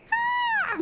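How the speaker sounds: short high mock shriek; fake scream. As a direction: squeal a badly faked scream. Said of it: "It's not very convincing".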